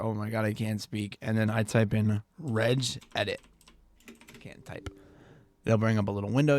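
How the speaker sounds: normal talking voice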